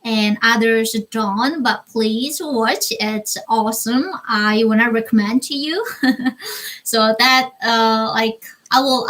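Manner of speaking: normal talking voice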